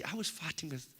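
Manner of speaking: normal talking voice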